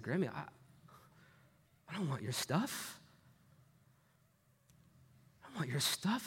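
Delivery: soft, halting plea to grandmother; small, hesitant voice, retold; retold hushed plea. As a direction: quietly plead as your younger self.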